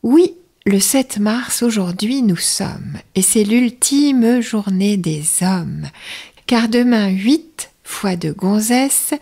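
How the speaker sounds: slow, unhurried verse reading; slow, savouring announcer read; slow take. Shown as a demonstration. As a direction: let each line breathe, take your time. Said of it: "je vais vous faire vite et pas vite".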